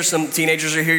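ordinary talk, nothing marked

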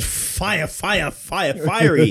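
ordinary talk, nothing marked

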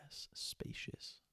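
breathy whispered word; hushed whisper. Real, not acted. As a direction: whisper it softly.

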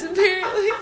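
ordinary talk

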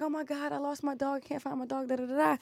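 high, soft mock-worried voice; imitated distressed woman. Woman tells crowd her dog is lost, mock-distressed high voice.

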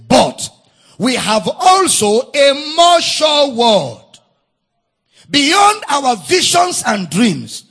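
slow, forceful pulpit delivery; emphatic preaching. Acted. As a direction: preach it out loud, stretching the key words.

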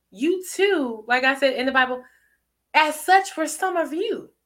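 ordinary delivery

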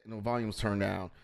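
normal talking voice

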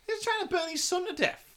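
high-pitched, baffled exclamation; real incredulity. Real, not acted. Podcaster reacts to a father burning his son, incredulous. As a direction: protest in sheer disbelief, voice right up.

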